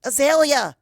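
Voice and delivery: loud, high alarmed yell; crying out a name. Acted. Twilight to Azalea falling away, alarmed shout. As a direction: scream her name as she is thrown. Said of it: "I cry".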